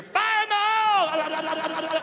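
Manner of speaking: high, loud, slow drawn-out yell; gunner shout. Retold or imitated. Gunner warns the crew before firing, long loud shout. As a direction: bellow it over the rotors. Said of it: "you just hear".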